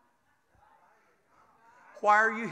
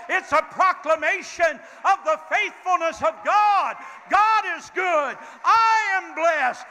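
full-force preaching; 3 semitones higher than usual. Acted. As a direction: preach it out to the rafters.

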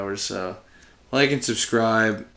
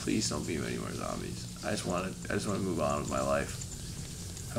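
scared plea; 9 semitones lower, 14 dB quieter than usual. Real, not acted. Scared of zombies, pleading.

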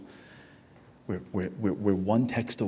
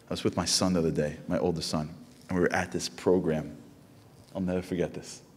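normal talking voice

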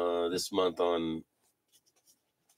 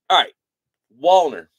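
ordinary talk, nothing marked

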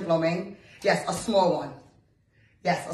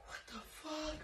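stunned whisper; 8 semitones higher, 21 dB quieter than usual. Pretend. Reacting to prank call.

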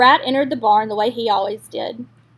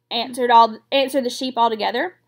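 plain reading voice

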